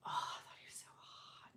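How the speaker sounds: hushed, breath-only confession; swooning whisper. Real, not acted. Recalling the biggest crush, swooning in a whisper.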